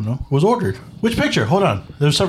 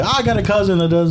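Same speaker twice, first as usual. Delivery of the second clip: higher-pitched mock New Jersey Italian, laughing; 5 semitones higher than usual. Teasing co-host about having a cousin for everything, New Jersey Italian impression. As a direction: put on a joking Italian-American Jersey accent. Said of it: "those Italian people in New Jersey".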